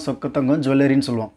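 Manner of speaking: usual speaking voice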